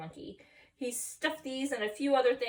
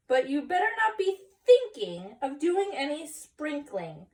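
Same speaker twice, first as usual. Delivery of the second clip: higher, louder stern mother voice; stern warning mom; 5 semitones higher, 5 dB louder than usual. Acted. Mama warns Skippy not to sprinkle, stern. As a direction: warn him off before he tries it.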